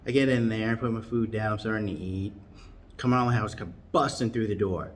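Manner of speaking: normal talking voice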